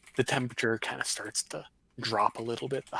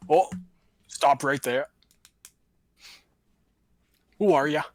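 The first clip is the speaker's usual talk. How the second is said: loud challenging yell, higher; yelling dwarves; 3 semitones higher, 10 dB louder than usual. Acted. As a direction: bark it out like road guards. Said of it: "fan out across the road and yell".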